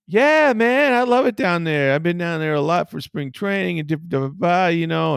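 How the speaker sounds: mock-enthusiastic manager imitation; Ozzie Guillen. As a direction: rattle on happily, hammed up. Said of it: "Ozzie Guillen walks up to me".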